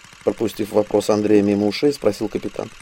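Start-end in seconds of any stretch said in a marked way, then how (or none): none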